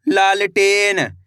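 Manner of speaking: लालटेन stretched out slowly, syllable by syllable; लालटेन slowly drawn out; slow blended word. Shown as a demonstration. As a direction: draw out लालटेन so each part is heard. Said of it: "कैसे पढ़ेंगे?".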